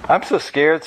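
lightly voiced frightened wife; tight voice. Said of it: "said Jill in a tight voice".

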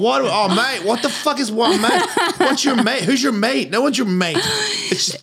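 high, laughing mock-Aussie rant at himself; exaggerated Australian accent. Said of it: "I'm not Australian anymore".

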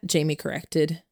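ordinary delivery, nothing marked

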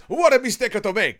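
high, loud mock Italian catchphrase; hammed-up Italian accent, thrown out loud; Captain Bertorelli. Illustrating the sitcom catchphrase, loud mock Italian accent. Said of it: "Captain Bertorelli".

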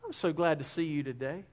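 hushed and pleasant, voice dropped low; soft sweet tone. Shown as a demonstration. Preacher showing the congregation a kind tone, soft and pleasant. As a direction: say it gently, glad and sincere. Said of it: "you can also use a certain tone".

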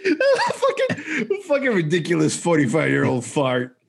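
talking through breaking laughter; real laughing. Real, not acted. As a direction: crack up while mocking yourself. Laughing at himself as he tells it.

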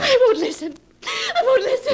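from loud to high frantic cry; panicked refusal, building. Acted. Wife refusing to hear the blackmailer, rising panic. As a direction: cover your ears, voice rising.

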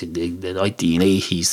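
usual speaking voice

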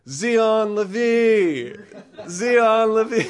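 high, drawn-out holler; calling out together. Retold or imitated. Two stuck friends calling for dad, hollering. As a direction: stretch the name into a long, high call. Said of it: "our joint voices will, will be more likely for him to hear".

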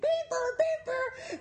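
high beep sounds; high mock censor beeps; imitated beep. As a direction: make bleep noises. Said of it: "des beep".

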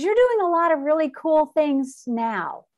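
a brighter, punched-up quote of the daughter; emphatic retelling. Retold or imitated. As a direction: repeat it with a push of enthusiasm. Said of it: "She goes".

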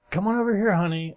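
put-on high sweet dad calling his kid. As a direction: call the little girl over sweetly. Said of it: "and he goes".

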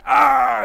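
high, loud mock death scream; imitated scream. Guy hit in the jugular, imitated scream. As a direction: scream like a movie extra hit by a blade. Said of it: "cutaway of him, like".

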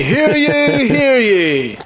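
high, ringing town crier; town crier call. Acted. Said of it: "And I say".

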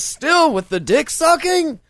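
high, slow mocking read. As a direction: read it in a squeaky mock voice. Reading comment in high voice.

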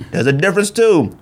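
ordinary delivery

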